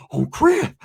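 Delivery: mock panting, then high gasped words; out of breath. Shown as a demonstration. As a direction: imitate a winded man gasping. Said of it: "They breathe like this".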